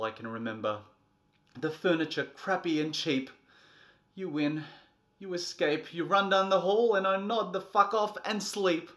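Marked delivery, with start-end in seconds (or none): 4.1-8.9 louder, higher, worn out; loud defeat. Acted. Beaten parent loudly admitting defeat to the child. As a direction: concede at full volume.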